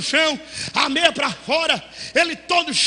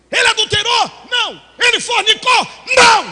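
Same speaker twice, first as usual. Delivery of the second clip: high, loud rhetorical questions; shouted questions; 7 semitones higher, 7 dB louder than usual. Retold or imitated. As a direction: fire each question and answer. Pastor grilling the mother, shouting.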